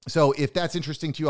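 usual speaking voice

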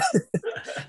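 high giggling burst of real laughter; a real laugh breaks out, high and short. Real, not acted. Laughing at the memory of the rival, a real high burst of laughter.

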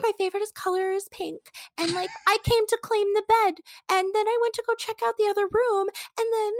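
high-pitched imitation, no accent; high girly voice. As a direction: mimic her in a high, bubbly voice. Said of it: "she's got a Southern accent, but I'm not gonna try".

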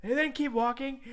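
normal talking voice

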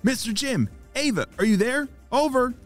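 high, eager radio call. Acted. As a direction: hail the team over the radio, pitched high. Jaden calls HQ by radio.

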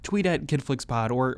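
ordinary delivery

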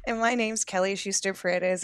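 usual speaking voice

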